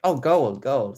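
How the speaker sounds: sudden loud "oh, gold"; surprised. Real, not acted. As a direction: caught off guard, delighted. Realises the cover is gold.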